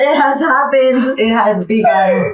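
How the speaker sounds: half-laughing teary voice; overcome, tears starting while joking; crying. Real, not acted. Friend overcome with tears after a heartfelt toast, teary. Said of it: "I'm crying".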